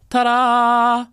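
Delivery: loud ta-da flourish. Real, not acted. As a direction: present it with a flourish. Presenting the sapling.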